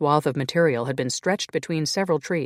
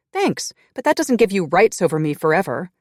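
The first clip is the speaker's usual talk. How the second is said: loud, higher, glaring retort; angry retort; 5 semitones higher, 7 dB louder than usual. Acted. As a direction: throw it back at him loudly. Grace glares, arguing.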